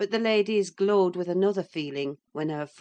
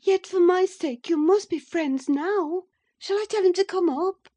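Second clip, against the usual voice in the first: put-on much higher, louder pleading young wife; eager Catherine; 14 semitones higher, 7 dB louder than usual. A narrator's reading. Catherine urges Edgar to befriend Heathcliff, eager and high. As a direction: press him eagerly for his blessing.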